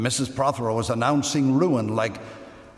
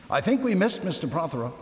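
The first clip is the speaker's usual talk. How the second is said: ordinary delivery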